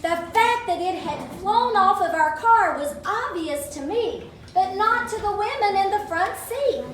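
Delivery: usual speaking voice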